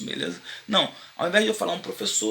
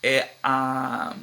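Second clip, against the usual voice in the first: ordinary talk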